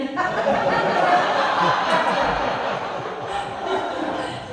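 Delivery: loud breathy burst of laughter; cracking up at the memory; laughing hard, high. Real, not acted. Burst of laughter after line.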